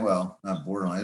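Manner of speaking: ordinary delivery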